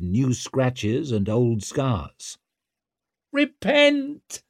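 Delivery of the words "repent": very high-pitched fanatic screech. A narrator's reading. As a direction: screech it like a frenzied street prophet. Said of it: "she was screeching".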